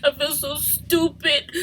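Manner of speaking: tearful and slowed, fighting sobs; crying. Real, not acted. Mother confiding to camera, crying. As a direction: voice breaking while crying. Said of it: "I just wanna cry".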